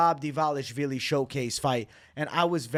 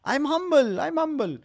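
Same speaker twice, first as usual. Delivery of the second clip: Merab impression; 11 semitones higher, 4 dB louder than usual. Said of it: "Worst Merab impression yet from me".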